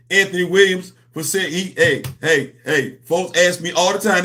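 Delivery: ordinary talk, nothing marked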